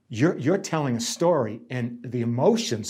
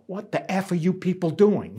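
mock angry nurse; 7 semitones higher than usual. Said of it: "they'd swear at me".

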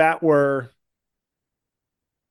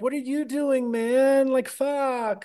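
whiny ranting comic; 8 semitones higher, slower than usual. Retold or imitated. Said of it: "not like".

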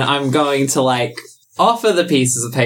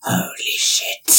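whispering snake; 5 dB quieter than usual. Acted.

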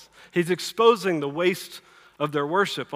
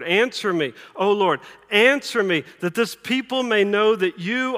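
loud, fervent plea slowing at the end; impassioned prayer; 4 dB louder than usual. Acted. Elijah praying before the people for God's answer, impassioned. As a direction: build insistently, then draw out the close.

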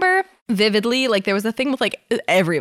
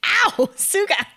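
mock yelp of pain; 12 semitones higher, slower than usual. Cheek pinched in story.

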